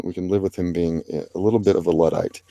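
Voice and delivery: ordinary talk, nothing marked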